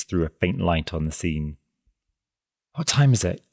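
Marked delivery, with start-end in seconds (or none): 2.7-3.4 high, urgent excited question. A narrator's reading. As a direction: blurt it out in excitement. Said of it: "exclaimed the girl in an excited tone".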